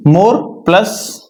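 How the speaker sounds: very slow, both words drawn out; slow dictation. Shown as a demonstration. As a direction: spell out the formula slowly. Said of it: "teacher stating the formula". (धीमे-धीमे बोलकर)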